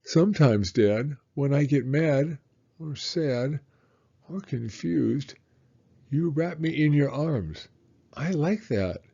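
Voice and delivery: a hint of a small boy talking to his dad; lightly voiced little boy. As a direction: read it as the excited little boy. Child voice reading son's lines.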